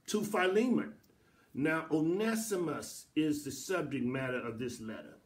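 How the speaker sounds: ordinary delivery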